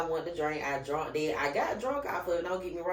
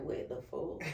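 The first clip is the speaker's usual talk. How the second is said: breathy hushed shock; 11 dB quieter than usual. Retold or imitated. Friend reacts to the test in a hushed shock. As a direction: mouth it in hushed disbelief. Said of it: "Ty was like".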